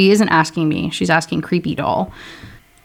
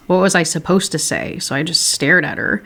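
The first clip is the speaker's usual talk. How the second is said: usual speaking voice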